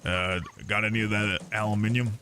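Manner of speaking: lightly put-on British voice, low; a hint of a Brit asking for "aluminium"; mock British accent. Streamer mimicking a British shopper for chat. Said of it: "I just say".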